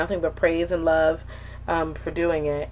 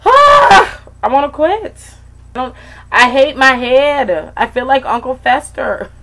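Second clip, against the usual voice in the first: loud, higher frustrated wail; frustrated outburst; 8 semitones higher, 12 dB louder than usual. Real, not acted. Venting to viewers about hating her bald head. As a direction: vent it all at once. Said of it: "I'm so frustrated".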